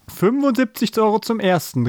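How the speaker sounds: high-pitched going-once call; auctioneer's call. A narrator's reading. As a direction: call it out over the crowd. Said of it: "rief der Bäcker Bommel".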